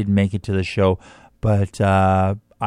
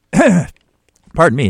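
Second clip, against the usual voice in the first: coughing and choking mid-sentence; 6 semitones higher, 5 dB louder, much slower than usual. Real, not acted. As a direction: choke and cough out the apology. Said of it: "I'm choking to death".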